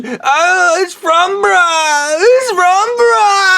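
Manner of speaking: high, slow blubbering whine; crying Stu impression. Mocking Stu on the phone, high slow crying whine. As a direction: sob out your despair like a broken man. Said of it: "he was".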